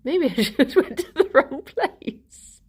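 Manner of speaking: laughing through the words. Real, not acted. Laughing at her own slip to viewers, giggling. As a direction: giggle while correcting yourself.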